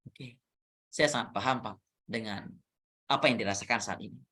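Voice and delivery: match the sad story with a hushed, caring voice; empathetic tone. Shown as a demonstration. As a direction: coach showing how to answer a sad colleague softly. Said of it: "meresponnya dengan".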